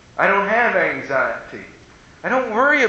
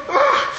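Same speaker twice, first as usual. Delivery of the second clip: very high mock-anxious groan; imitating a mother's frustrated squeal; exasperated groan. Anxious mother fooled by a trivial question, exasperated groan. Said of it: "she'd get more anxious".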